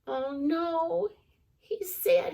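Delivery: usual speaking voice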